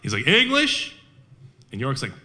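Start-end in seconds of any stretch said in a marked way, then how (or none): 0.3-0.9 high, questioning call across gunfire. Retold or imitated. German officer calling to York over gunfire, high questioning call. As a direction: yell the question over the shooting, pitch up. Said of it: "he's yelling".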